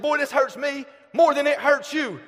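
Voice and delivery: a father's hammed-up lecture to his crying son; dad's line. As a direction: ham up the father's solemn line.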